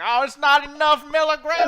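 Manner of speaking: high, loud, slow southern drawl; southern accent. Pretend. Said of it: "do the southern accent".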